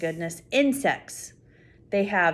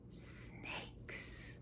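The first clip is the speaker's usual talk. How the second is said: whisper; 23 dB quieter than usual. Pretend. Spooky reveal to child.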